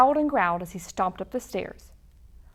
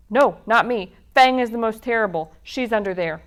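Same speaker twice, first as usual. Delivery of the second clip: loud, quick, nervous beast; 7 dB louder, faster than usual. A narrator's reading. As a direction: blurt it out fast. Scared beast points elsewhere.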